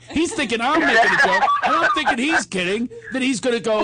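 ordinary talk

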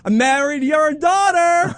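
high, slow mock yell at the in-laws; hammed-up defiant yell. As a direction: drag it out like a cocky son-in-law shouting at the in-laws. Defiant son-in-law yelling at family.

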